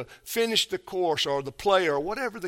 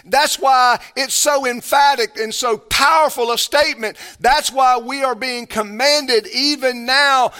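louder, higher pulpit preaching; full-force preaching; 7 semitones higher, 6 dB louder than usual. Acted. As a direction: preach it with urgency and weight.

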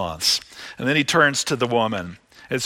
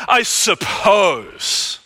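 slow, ringing shout of I suppose; preacher echoing Simon's words to the congregation; mocking shout; 4 semitones higher, much slower than usual. Mocking echo of Simon's hedge.

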